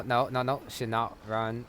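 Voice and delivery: usual speaking voice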